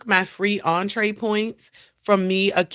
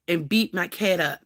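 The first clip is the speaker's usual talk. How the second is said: ordinary talk, nothing marked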